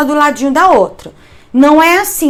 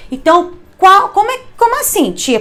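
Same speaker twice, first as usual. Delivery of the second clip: higher-pitched, a pupil's confused question to the teacher; puzzled pupil; 7 semitones higher than usual. Retold or imitated. Teacher voicing a confused pupil who asks her, higher. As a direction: play the confused kid asking "tia".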